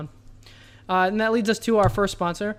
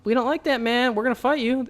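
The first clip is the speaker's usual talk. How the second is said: defiant colonists; 5 semitones higher than usual. Retold or imitated. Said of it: "American colonists who were like".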